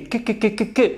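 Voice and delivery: "que" repeated as a hard k; hard k sound. Shown as a demonstration. Teacher shows the hard C sound in a playful way. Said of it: "ça fait franquet".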